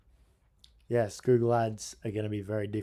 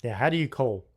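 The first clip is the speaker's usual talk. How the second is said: normal talking voice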